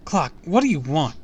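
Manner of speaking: high, loud plea; frantic confrontation; desperate demand. A narrator's reading. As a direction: demand an answer in panic. Desperate narrator confronts Clark over screaming kids.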